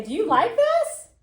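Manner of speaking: high, loud, eager question; mom asking. Acted. Mom to her child in a store, high-pitched asking. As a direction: pitch it way up like a mom holding something up. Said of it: "mom holds up something and she wants to say".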